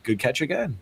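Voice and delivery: chirpy AI person. Retold or imitated. AI-as-person repeating its stock praise, mock chirp. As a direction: say it with hollow cheer. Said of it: "And he's like".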